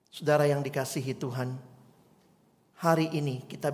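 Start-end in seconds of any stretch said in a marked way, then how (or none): none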